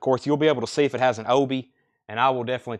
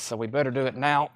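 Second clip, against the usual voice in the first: normal talking voice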